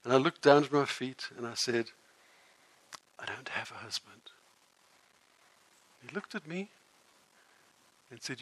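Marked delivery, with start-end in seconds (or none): 3.2-4.3 whispered, eyes down in shame; ashamed whisper. Acted. Woman admitting to the teacher she has no husband, whispered. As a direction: barely get the words out. Ashamed, looking at her feet.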